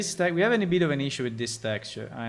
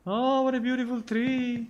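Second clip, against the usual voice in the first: much higher, dreamy and marveling; high wonder voice; 7 semitones higher than usual. Shown as a demonstration. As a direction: say it like you're in awe of a fairy tale. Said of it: "repeats with new delivery".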